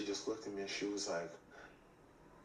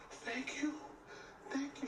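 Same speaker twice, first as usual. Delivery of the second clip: high, teary imitation of a crying fan; mock tearful thanks; 4 dB quieter than usual. Fan thanks him, mock tearful and high. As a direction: squeak it out like she's about to cry. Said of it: "She starts crying".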